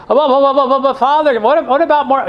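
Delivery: high, loud mock sputtering; flustered objecting parishioner.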